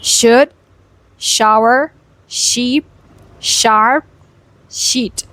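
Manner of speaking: isolated words with strong initial sh; "Should, shower, sheep, sharp, sheet" one at a time; word-by-word SH practice; sharp SH drill. Shown as a demonstration. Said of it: "Try practicing these words".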